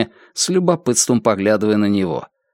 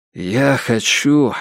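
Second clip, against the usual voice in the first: very slow, careful start; choosing words carefully; much slower than usual. A narrator's reading. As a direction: feel for each word slowly. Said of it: "осторожно подбирая слова".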